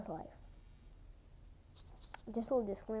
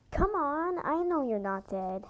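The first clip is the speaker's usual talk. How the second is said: high, louder playful tease; teasing mother; 5 semitones higher, 5 dB louder than usual. Acted. Mother teasing her kit awake, playfully.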